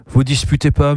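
ordinary delivery

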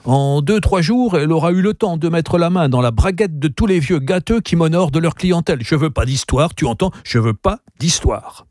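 high, agitated tirade; exasperated innkeeper railing; indignant crying out. A narrator's reading. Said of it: "cria Turando".

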